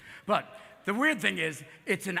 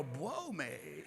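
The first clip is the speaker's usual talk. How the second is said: Elmer Fudd; 6 semitones lower, 14 dB quieter than usual. Retold or imitated. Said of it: "Elmer Fudd would never go".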